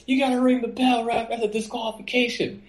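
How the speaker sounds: high mock yell from the crowd; fan yelling. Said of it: "a fan yells to him".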